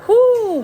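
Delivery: high, loud breathy whew; winded whew. Real, not acted. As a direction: blow out air after a hard stretch. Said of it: "a little bit out of breath".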